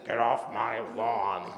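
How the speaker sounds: put-on slow, gruff old-man grumble; grumpy old man. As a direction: growl it like a cranky neighbour. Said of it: "I am that grumpy old man".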